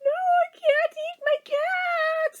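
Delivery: put-on high, slow, wailing little kid; high teary child's wail; crying child. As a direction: sob it out like a five-year-old. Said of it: "I was like".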